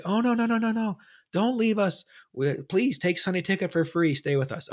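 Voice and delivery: high, pleading customer-service voice; pleading phone rep. Retold or imitated. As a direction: beg him not to cancel in a squeaky high voice. DirecTV rep begs him to stay.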